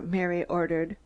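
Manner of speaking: usual speaking voice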